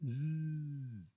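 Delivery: soft, long "zzz"; soft sustained buzzing; imitated buzz. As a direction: buzz quietly and hold it.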